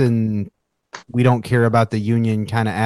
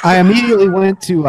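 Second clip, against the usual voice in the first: louder and higher, laughing out the first words; laughing burst; 9 semitones higher, 4 dB louder than usual. Real, not acted. Jumping in to share his idea, laughing. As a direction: blurt it out through a laugh. Excited jump-in about a flag guy.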